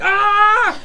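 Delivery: sudden loud war cry; battle yell. Acted. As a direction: scream it out on pure adrenaline.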